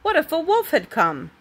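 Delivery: worried scolding. A narrator's reading. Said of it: "cried Grandfather".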